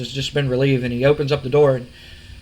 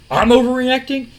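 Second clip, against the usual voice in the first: rising disbelief; 8 semitones higher than usual. Retold or imitated. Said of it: "he goes".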